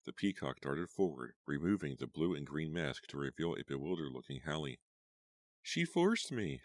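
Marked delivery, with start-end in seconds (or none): 5.6-6.6 high, slow anguished cry. A narrator's reading. Hallie crying out over Brenda's body, high and slow. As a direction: plead it, near tears. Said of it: "Hallie cried".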